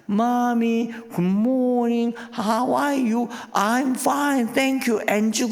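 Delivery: put-on high sing-song little kid voice; child's English greeting. Preacher mimics kindergartner greeting mom in English, high chirpy child voice.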